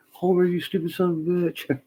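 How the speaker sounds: high, loud exasperated scolding; mock angry yell. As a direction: holler at a misbehaving dog. Acted scolding of his dog.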